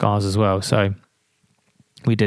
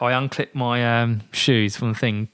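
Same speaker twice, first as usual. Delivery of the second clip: ordinary delivery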